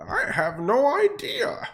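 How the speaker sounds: very high falsetto lady; puzzled woman. Acted. Cat's owner answering about the monster, puzzled. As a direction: plead ignorance in a put-on high voice. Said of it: "She says".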